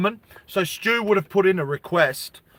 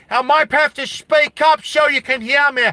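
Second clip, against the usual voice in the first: loud, high, raised voice to be heard; half-joking yell so the chat can hear; yelling over noise; 9 semitones higher, 6 dB louder than usual. Real, not acted. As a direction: shout it up over the rain.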